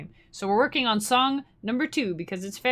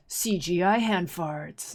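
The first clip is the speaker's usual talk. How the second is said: slow, lightly voiced cool trailer narrator; cool guy trailer voice; slower than usual. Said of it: "cool guy voice".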